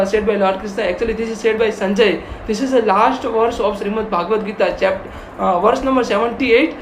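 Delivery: ordinary talk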